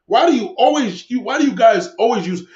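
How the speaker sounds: normal talking voice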